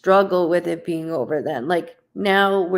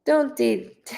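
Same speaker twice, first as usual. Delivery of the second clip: higher, pointed warning; mock the mother's warning, pitched up; imitating mom; 7 semitones higher, slower than usual. Mother warning daughter not to date, higher. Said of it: "She goes".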